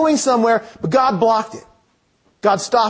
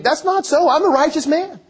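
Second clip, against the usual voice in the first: higher-pitched, mildly protesting Job; indignant protest; 6 semitones higher than usual. Acted. Job answering friends who act holier than him, protesting. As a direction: object in a raised pitch, defending yourself. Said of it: "I think he's kind of saying".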